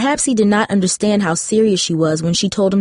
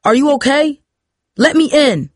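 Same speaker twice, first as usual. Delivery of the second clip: higher-pitched calling through a door; urgent cry; 7 semitones higher, 4 dB louder than usual. A narrator's reading. Father outside the apartment door, urgent cry. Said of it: "cried a familiar voice".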